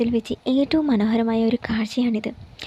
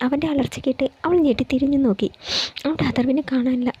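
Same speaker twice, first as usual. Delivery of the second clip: ordinary delivery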